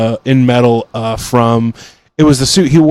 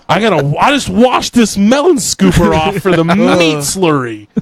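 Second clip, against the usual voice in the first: higher, put-upon cook voice; mock-grumbling cook stuck remaking tacos; 4 semitones higher than usual. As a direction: complain like an annoyed fry cook. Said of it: "and was like".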